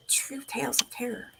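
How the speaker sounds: whispered spooky title. Acted. Streamer shows chat a ghost book, whispering. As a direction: breathe the title like a secret.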